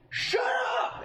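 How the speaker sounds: sudden high mock yell; retold outburst. Moviegoer turning on a phone talker, acted outburst. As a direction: burst out at them, re-enacted.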